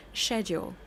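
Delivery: mock posh English "Schedule", sh sound. Imitating a posh English speaker saying "Schedule". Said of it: "ask them how to say the word".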